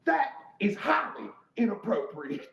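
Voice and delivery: loud, high, slow scolding; mock-prim outrage, each word drawn out; Karen-like indignation. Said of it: "Sounded like a Karen".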